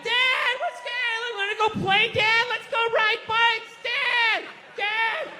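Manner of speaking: put-on happy kid. Little boy begging his dad to go ride bikes, eager and high.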